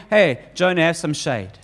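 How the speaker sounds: gentle offer. Acted. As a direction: offer shade to the sulking prophet tenderly. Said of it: "What does God do?".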